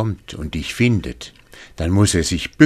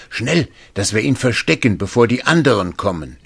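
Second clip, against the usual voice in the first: urgent call; 6 semitones higher, 4 dB louder, faster than usual. Acted.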